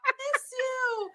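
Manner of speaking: very high, drawn-out mock call; imitated friend's yell. Imitating friend greeting her at church, high drawn-out call. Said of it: "she just yells".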